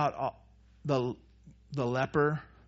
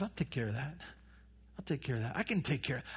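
much softer, low-key assurance; quiet reassurance; 4 semitones lower, 12 dB quieter, much faster than usual. Acted. Jesus to the sick and paralyzed, quietly reassuring. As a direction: say it softly, as no trouble at all. Jesus calmly taking on diseases.

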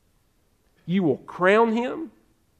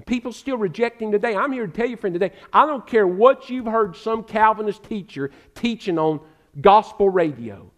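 ordinary delivery

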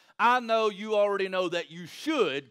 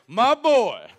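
louder, higher proud exclamation; proud excitement; 4 semitones higher, 11 dB louder than usual. Retold or imitated. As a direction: cheer for your son like a proud dad. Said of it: "I was so excited".

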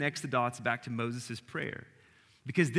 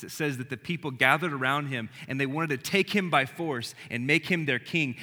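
usual speaking voice

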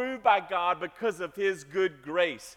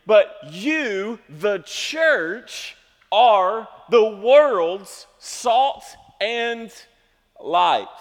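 loud, rising sermon crescendo, each "not" punched; preaching at full force; 2 semitones higher, 7 dB louder, slower than usual. Acted. Pastor telling his church the world's hope is them, loud preaching. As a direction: build the list and hit "you, the church" hardest.

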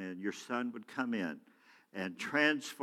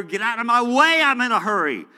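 loud, high, impatient shout; mock road-rage holler, much louder; retold yelling; 12 semitones higher, 13 dB louder than usual. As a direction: yell it at people blocking the corner. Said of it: "I'm screaming and yelling".

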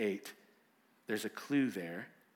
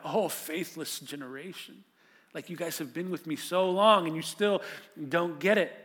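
louder, fuller exasperated lament; lament from the gut; 5 semitones higher, 6 dB louder than usual. Acted. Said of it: "It's coming from his gut".

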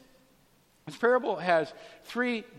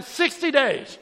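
loud, high sentencing yell; retold judge's shout; 9 semitones higher, 9 dB louder, slower than usual. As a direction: hammer it out like an irate judge. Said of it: "he hammers her down again".

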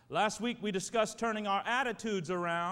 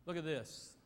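ordinary talk

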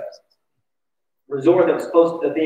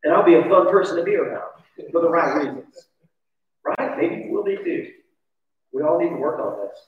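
normal talking voice

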